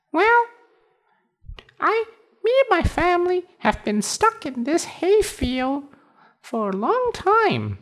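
very high tiny cricket voice. Acted. Cricket explains.

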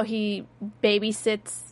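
ordinary delivery

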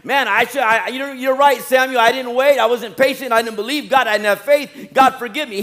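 loud, high-pitched plea for forgiveness; crying out for mercy. Acted. Preacher voicing Saul begging God for mercy, crying out loudly. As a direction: cry out an urgent, acted confession. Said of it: "he would have cried out".

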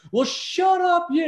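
high-pitched mock yell; acted-out angry holler; retold shouting back. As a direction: imitate an irritated golfer yelling back across the course. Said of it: "screams back at him".

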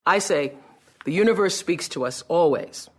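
ordinary delivery

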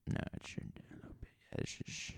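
fast whispered mutter; whispered aside. Acted. Host whispers an off-mic aside quickly.

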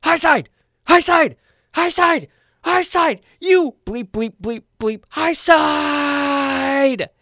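high, loud, frantic shouting at a race; frantic yelling. Retold or imitated. Race driver's dad yelling at his son's restart choice, frantic high shouts. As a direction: imitate a panicked dad screaming at the track. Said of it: "I'm hearing Jeff go".

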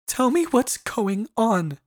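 high, slow demand; commanding. A narrator's reading. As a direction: order him to explain. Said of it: "Pearl commanded".

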